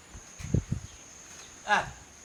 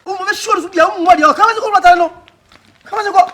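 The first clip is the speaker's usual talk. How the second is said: high shouting; 9 semitones higher, 6 dB louder than usual. Acted. Heated back-and-forth argument.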